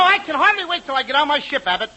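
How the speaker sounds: ordinary delivery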